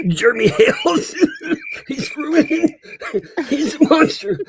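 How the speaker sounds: high, breathless laughing; laughing hard. Real, not acted. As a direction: squeal it through giggles. Laughing fit over name slip.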